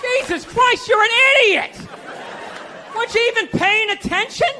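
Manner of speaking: put-on rip into the kid like a furious father; angry dad. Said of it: "He looked, you".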